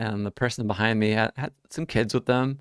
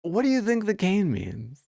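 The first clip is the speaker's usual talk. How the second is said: higher, pointedly sarcastic retort; 9 semitones higher than usual. Retold or imitated. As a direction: answer with a pointed edge. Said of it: "and I finally said".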